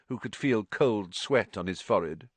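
ordinary delivery, nothing marked

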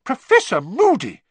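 high, loud shocked exclamation. A narrator's reading. McGonagall finds Moody bouncing a ferret, shocked. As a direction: call out, appalled. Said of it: "said a shocked voice".

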